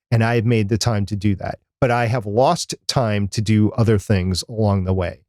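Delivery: usual speaking voice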